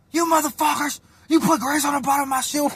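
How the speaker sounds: high-pitched mock-outraged yell; imitated angry accusation. Prank victim accusing his cellmates, high angry shout.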